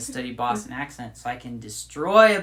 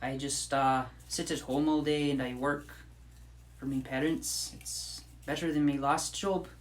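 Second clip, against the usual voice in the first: softer, toned-down Irish lilt; milder Irish accent; 5 dB quieter than usual. Pretend. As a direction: chat in an understated Irish accent. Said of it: "I'll talk in a less Irish accent".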